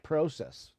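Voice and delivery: "process" with a long O, PRO-sess; Canadian pronunciation. Shown as a demonstration. Streamer preferring the Canadian way, long-O "process". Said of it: "if I was Canadian, I'd say".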